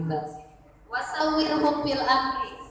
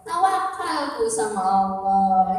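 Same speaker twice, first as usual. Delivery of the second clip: ordinary delivery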